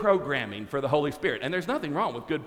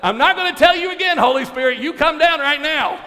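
fast, loud, high angry order; angry command; 10 semitones higher, 8 dB louder, faster than usual. Retold or imitated. Pastor angrily orders the Holy Spirit down. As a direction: lose patience and shout it. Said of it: "he's getting angry".

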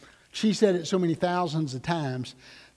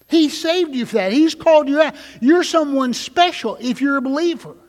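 high and louder, building passion; rising preaching shout; 6 semitones higher, 6 dB louder than usual. Acted. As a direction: lift the congregation with it. Preacher climax.

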